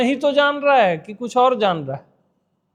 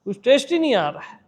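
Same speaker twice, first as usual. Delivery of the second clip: higher, mock grumbling seeker; imitated listener's complaint; 6 semitones higher than usual. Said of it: "ऐसा बोलते हो आप".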